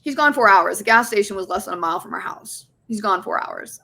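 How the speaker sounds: normal talking voice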